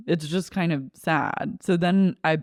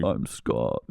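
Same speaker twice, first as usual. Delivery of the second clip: much lower mock-male voice; pretend boy voice; 4 dB quieter than usual. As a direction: drop way down to play a boy. Voices the boy-wannabe as Scott.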